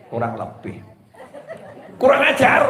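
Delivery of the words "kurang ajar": high, loud mock outrage with a laugh; jokey mock indignation. Preacher jokes to the audience with mock outrage. Joke mishearing kurang lebih.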